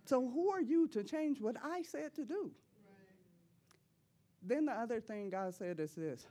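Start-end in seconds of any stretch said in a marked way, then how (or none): 0.1-2.5 stern rebuke. Retold or imitated. Voicing God's rebuke to her.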